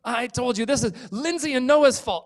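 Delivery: high, loud whiny accusation; blaming kid. Acted. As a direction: point the finger at the others. Kids blaming each other.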